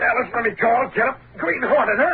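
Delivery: high, straining anger; furious. Acted. Husband fighting free of his wife to chase the robber. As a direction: push past her, raging.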